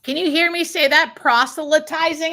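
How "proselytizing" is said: Proselytizing asked high with a rise; testing proselytizing pronunciation; checking pronunciation. Shown as a demonstration. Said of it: "Did I say it right".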